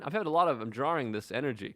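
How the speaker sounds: ordinary talk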